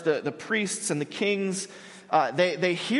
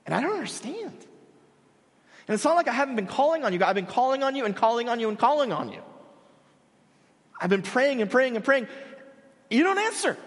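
pitched way up and rushing, pleading with God; anguished pleading prayer; 7 semitones higher, faster than usual. Retold or imitated. Retelling his prayers to God during anxiety, anguished high pleading. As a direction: beg God for an answer, voice climbing.

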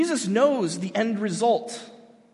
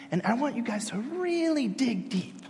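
mock soul-searching; 4 semitones higher than usual. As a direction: coax them to look inward, exaggeratedly. Parody of Jesus leading self-discovery circle.

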